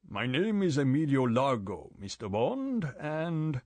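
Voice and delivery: lightly voiced suave ship owner; a hint of a smooth host; Largo. A narrator's reading. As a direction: Largo introducing himself to Bond, lightly suave. Largo character voice.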